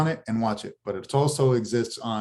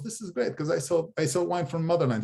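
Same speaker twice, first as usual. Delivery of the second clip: lightly imitated Russian accent, higher; a hint of a Russian immigrant voice; 5 semitones higher than usual. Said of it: "he's got the heavy Russian accent".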